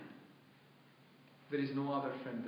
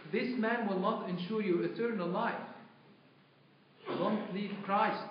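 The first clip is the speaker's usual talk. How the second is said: loud, high, insistent warning; raised voice, driving the warning home; urgent plea; 8 semitones higher, 6 dB louder than usual. Retold or imitated. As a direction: plead forcefully as a priest warning his daughter in faith. Priest pleading with woman in story.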